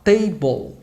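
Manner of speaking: Table said TEI-bol, stress on the first syllable; spoken pronunciation. Shown as a demonstration. Teacher shows students how Table is spoken. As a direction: say Table the natural English way. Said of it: "o jeitinho de falar".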